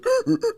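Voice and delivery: high, loud hee-haw bray; donkey laugh imitation. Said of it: "and the donkey goes".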